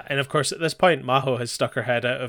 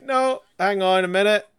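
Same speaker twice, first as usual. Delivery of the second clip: higher, louder protest; Maho cuts in angrily; 6 semitones higher, 5 dB louder than usual. Retold or imitated. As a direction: step in hard to stop him. Maho interrupting an argument.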